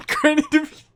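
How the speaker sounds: high laughing squeal. Real, not acted. Laughs, high excited repeat.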